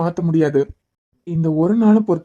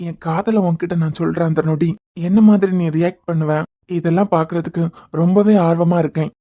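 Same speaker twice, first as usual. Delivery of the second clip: ordinary talk, nothing marked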